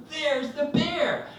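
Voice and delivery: high, slow mock shriek; imitated scream. Wife showing husband the bear, screaming. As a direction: stretch it out in high-pitched panic.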